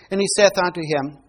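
usual speaking voice

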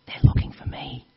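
breathy whispered secret. Acted. Hiding boy confiding to the telemarketer, whispered. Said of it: "Still whispering".

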